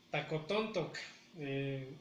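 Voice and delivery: normal talking voice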